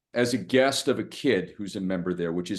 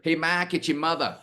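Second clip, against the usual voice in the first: imitating mom; 9 semitones higher than usual. Retelling mom's phone call.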